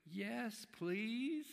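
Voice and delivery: small high distant voice, pleading; tiny voice. Retold or imitated. Drunk man answering the husband from the swing, a tiny high plea. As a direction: squeak it out like a tiny faraway voice. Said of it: "he hears the voice".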